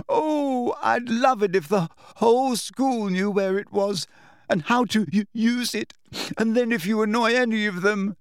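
high, tearful rant; sobbing. A narrator's reading. Hermione lashing out at Umbridge, sobbing. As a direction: spite through tears, stumbling on words. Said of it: "now sobbing into her hands again".

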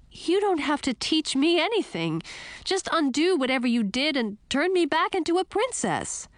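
high-pitched, insistent princess; demanding princess. Acted. Princess telling Frog to undo the spell, high and demanding.

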